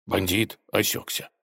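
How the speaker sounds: ordinary delivery, nothing marked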